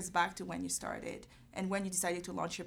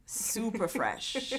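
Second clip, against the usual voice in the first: playful tease; 3 semitones higher, much slower than usual. Acted. Host teasing guest's youth.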